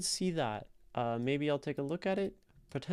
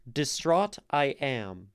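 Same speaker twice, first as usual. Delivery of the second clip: slow, loud "Distraught I am", pounding "-traught" and "am"; exaggerated iambic; 3 semitones higher, 5 dB louder, slower than usual. Shown as a demonstration. Poetry teacher putting the word into iambic structure, exaggerated stress. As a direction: overdo the weak-STRONG beat in "Distraught I am". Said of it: "like, the exaggerated iambic structure".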